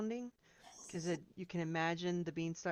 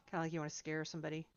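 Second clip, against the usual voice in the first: ordinary talk, nothing marked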